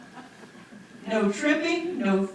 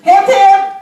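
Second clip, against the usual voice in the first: high, loud cheerleading call; cheering shout; 9 semitones higher, 11 dB louder, slower than usual. Real, not acted. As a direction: lead the crowd in a rousing cheer.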